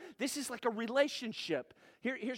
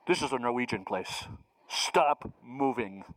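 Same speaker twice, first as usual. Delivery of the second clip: much lower and louder, mock grumpy scolding God; stern mock God; 9 semitones lower, 6 dB louder than usual. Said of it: "think God is up there saying".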